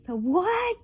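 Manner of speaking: loud high retort; high indignant shout; indignant so what. Real, not acted. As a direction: shout it back defiantly. Indignant outburst.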